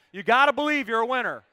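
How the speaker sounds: high, full-volume rallying cry; rousing shout. Shown as a demonstration. Preacher urging people to look each other in the eyes, shouting. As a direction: shout it to fire up the room.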